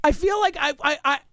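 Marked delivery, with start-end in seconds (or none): none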